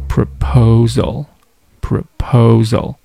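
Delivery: "proposal" slow, long stressed "po", light first and last syllables; middle-syllable stress. Shown as a demonstration. Teacher models the word, "proposal" stressed on "po". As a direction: stretch the middle of "proposal", keep its ends short. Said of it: "用来突出中间的这个音节".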